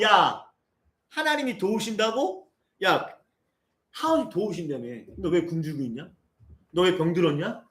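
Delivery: scornful taunting crowd, rising to a laughing sneer; mocking jeer. Worldly people sneering at poor Lazarus, mocking laughter. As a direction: jeer at him like a mocking neighbour.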